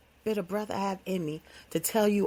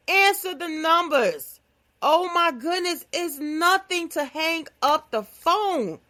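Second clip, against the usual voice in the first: higher, louder and slow, each word pressed; pleading insistence; 7 semitones higher, 3 dB louder, slower than usual. Real, not acted. [name] begs her listeners to answer unknown numbers, slow and loud. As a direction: plead with them, drawn out and loud. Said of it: "And I'm serious".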